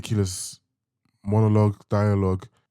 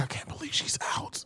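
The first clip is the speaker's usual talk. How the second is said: high, breathy imitating whisper; 10 dB quieter than usual. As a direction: whisper it like a shaken villain. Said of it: "The whispers are finishing me".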